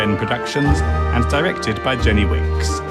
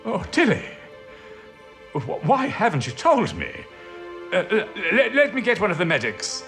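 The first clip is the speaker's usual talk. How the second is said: high, distressed and stammering; acted alarm for an ill friend; shocked concern; 8 semitones higher, faster than usual. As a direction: voice lifted in dismay, then hurrying to help. Hears of colleague's cancer.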